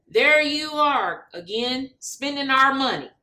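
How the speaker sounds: Mister Bill needles Chris Smith in the debate; scornful politician jab. Acted. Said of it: "says Mister Bill".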